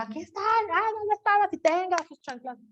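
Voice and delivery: high-pitched mock mother voice; mother scolding. Mother finds the child and scolds her in a high voice.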